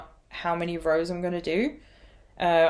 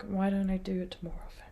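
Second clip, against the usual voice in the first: whispered, much softer question to herself; whispered thought; 9 dB quieter than usual. Retold or imitated. Asking herself about monogamous knitting, whispered. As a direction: breathe it out as a private thought. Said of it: "So I'm like".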